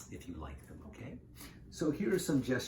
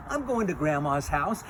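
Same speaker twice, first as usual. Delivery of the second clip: put-on loud, higher little-girl voice; 5 semitones higher, 8 dB louder than usual. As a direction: pipe up loudly as a storybook child.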